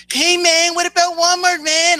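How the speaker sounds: high mocking voice, parroting a rival youtuber; mocking imitation. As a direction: throw his words back in a whiny voice. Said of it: "all of a sudden you're gonna imitate".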